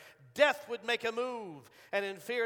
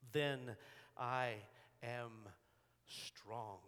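hushed, solemn; 8 semitones lower, 9 dB quieter, much slower than usual. Acted. Solemn close of scripture quote.

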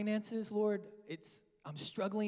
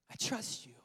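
whispered prayer; 6 dB quieter than usual. Real, not acted.

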